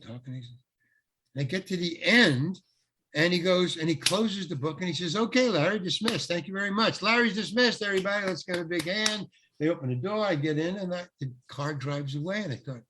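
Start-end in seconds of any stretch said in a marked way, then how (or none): 5.2-9.3 high-pitched call to the whole crew; director's announcement. Retold or imitated. Director closing the book after the take, mock announcement. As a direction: imitate a brisk public send-off.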